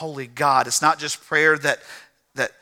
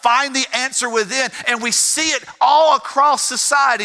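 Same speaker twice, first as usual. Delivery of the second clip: raised, driving pulpit voice; impassioned preaching; 7 semitones higher than usual. Real, not acted. Preacher warning the congregation about self-worship, impassioned preaching. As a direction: preach it higher, pressing each phrase.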